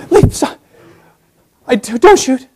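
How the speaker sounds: whispered then high cry of surrender; a cornered criminal pleading not to be shot; panicked criminal. Acted. Exposed criminal begs police, panicked. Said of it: "the criminal exposed".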